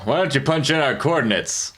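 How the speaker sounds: smooth cocky captain voice; dandy voice. Acted. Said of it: "You really like doing the dandy voice".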